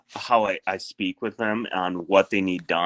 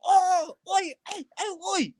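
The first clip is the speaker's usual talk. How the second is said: much higher mock calls to the guys up top; squeaky shouted warning, played for laughs; workers calling out. Storyteller imitates workers yelling "oi", high mock calls. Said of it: "And they're like".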